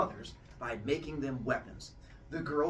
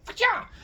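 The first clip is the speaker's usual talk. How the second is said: very high, loud zap noise; lightning sound effect; 7 dB louder than usual. Pretend. Teacher imitating Zeus throwing lightning bolts. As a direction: blast it like a bolt leaving your hand.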